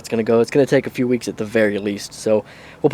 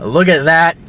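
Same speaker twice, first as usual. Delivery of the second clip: mock Cockney accent; 2 semitones higher, 3 dB louder, slower than usual. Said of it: "not sure if I'm doing a Cockney accent".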